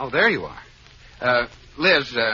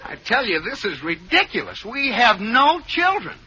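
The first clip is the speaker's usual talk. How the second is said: higher-pitched indignant denial; exasperated protest; 9 semitones higher than usual. Acted. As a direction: protest your innocence to the doctor. Husband protests false accusation.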